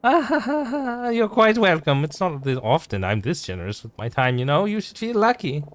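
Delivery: high, haughty society lady. Acted. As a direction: look down your nose, pitched high. Same lady laughing grandly.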